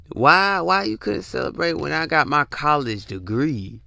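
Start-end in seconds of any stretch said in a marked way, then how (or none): none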